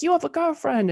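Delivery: a hint of an Australian accent, high; mock Australian girlfriend accusation; lightly put-on Australian accent. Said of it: "that was my Australian accent".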